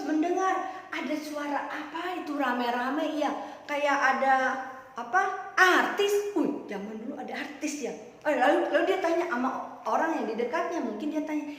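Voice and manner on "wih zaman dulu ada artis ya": ordinary delivery, nothing marked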